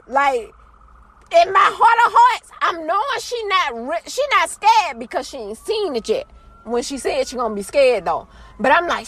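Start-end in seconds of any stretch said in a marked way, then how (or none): none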